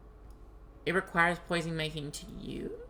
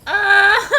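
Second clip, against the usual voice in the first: shrieking burst of laughter; high screaming cackle; loud high laugh; 11 dB louder than usual. Real, not acted.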